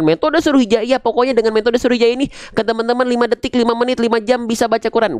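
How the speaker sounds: high-pitched, hurried mock promotion; mock sales boast. Teacher mimics promoters of reading methods, high mock boasting.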